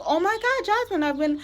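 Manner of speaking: high, bubbly fake-friendly voice; mock sugary saleswoman. Mocking the saleswoman who signed her up, high fake-cheery voice. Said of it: "she was like".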